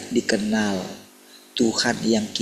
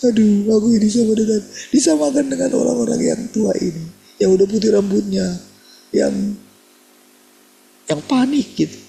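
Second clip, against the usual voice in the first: much higher, louder, crying-panic voice; panicky wife; 13 semitones higher, 6 dB louder than usual. Retold or imitated. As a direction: play the frightened wife near tears, pitched high. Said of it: "dia nangis lah". (suara istri panik, tinggi dan keras)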